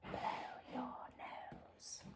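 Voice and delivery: hushed, unvoiced whisper. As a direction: breathe the words softly. Story refrain whispered.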